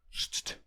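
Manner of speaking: unvoiced soft shush shush. Marcus wants silence, whispered shush shush. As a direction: whisper a shush to make her be quiet. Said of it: "Marcus vult silentium".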